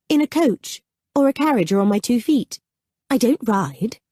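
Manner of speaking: put-on a young woman reasoning matter-of-factly; woman's voice. A narrator's reading.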